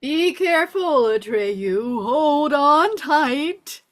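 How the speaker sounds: loud, drawn-out urgent call; shouted warning. Acted. Falkor warning Atreyu as the gale hits, shouted. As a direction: shout over the storm, stretching the last words.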